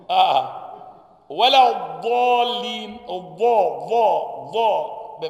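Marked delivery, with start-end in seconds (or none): 1.3-5.3 ولا الضالين slow, the ضا from the tongue edge; drawn-out ضا at the molars; correct side dad. Shown as a demonstration. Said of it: "حافة اللسان عند الأضراس". (نطق الضاد من حافة اللسان)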